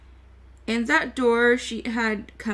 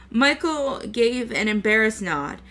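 plain reading voice

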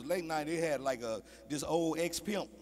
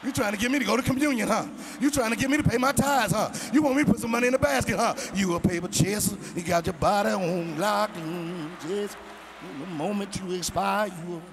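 [name] impression; 3 semitones higher, 5 dB louder than usual. Visitor rapping at pushy choir like [name]. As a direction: rap it at the choir, building. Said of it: "I done turned into juvenile on 'em".

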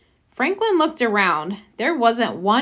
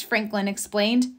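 ordinary delivery

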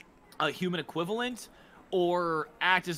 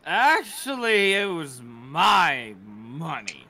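put-on slurring drunk; 7 dB louder, much slower than usual. Drunk guest protests.

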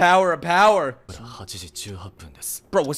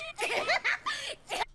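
squealing laugh; 9 dB quieter than usual. Real, not acted. High laugh.

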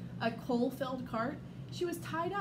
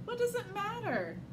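scornful, pitched up high; sneering; 10 semitones higher than usual. A narrator's reading. Bun Bun mocks Pony Ella, sneering and high. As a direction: sneer it down at her. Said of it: "Bun Bun sneered".

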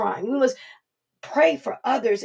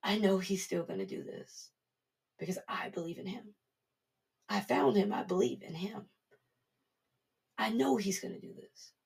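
hushed, much softer inner assurance; quiet confident faith; 10 dB quieter than usual. Retold or imitated. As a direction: say it to yourself, calm and sure. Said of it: "Jairus is still standing there looking like".

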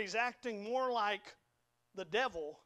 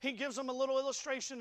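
ordinary talk, nothing marked

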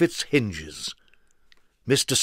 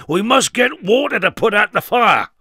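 higher, louder urgent command; urgent Mister Strong; 9 semitones higher, 8 dB louder than usual. Acted. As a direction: press it on the farmer. Mister Strong urging action.

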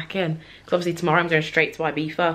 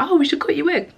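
high, louder imitation of mum; lightly voiced mum; 9 semitones higher, 4 dB louder than usual. Mum suggesting a wig cut, lightly imitated in higher voice. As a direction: pipe up brightly as mum suggesting it. Retelling mum's suggestion in her voice.

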